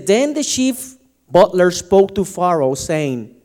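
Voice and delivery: lightly formal reading voice; scripture reading. Acted. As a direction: read the verse out to the congregation. Reads Genesis aloud.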